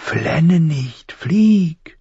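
high, thin command. A narrator's reading. Crow orders crying boy to fly, high and thin. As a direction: pitch it up into a thin, urging creature voice. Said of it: "Die Stimme klang hoch und dünn".

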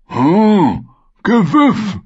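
sehr hoch, laut und langsam gedehnt; Vargo Hoth. Acted. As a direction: jedes Wort genüsslich und sabbernd ziehen. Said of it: "antwortete Vargo Hoth". (der Söldnerführer freut sich über den Fang, hoch und gedehnt)

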